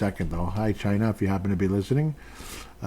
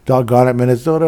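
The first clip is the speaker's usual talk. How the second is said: mock frustration; 3 semitones higher, 11 dB louder than usual. Mock frustration at Minnesota listeners.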